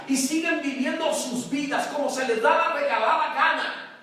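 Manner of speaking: loud, high, driving sermon voice; fiery preaching. Acted. Pastor preaching to the church, loud and fiery. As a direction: hammer it home to the congregation.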